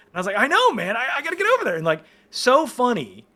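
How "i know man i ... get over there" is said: high, eager, raised-voice retelling; giddy urgency. Retold or imitated. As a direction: act out a fan working up the nerve. Retold starstruck urge to go over.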